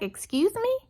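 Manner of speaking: high, disbelieving "excuse me"; shocked, offended question; indignant excuse me. Retold or imitated. Indignant reply to waitress.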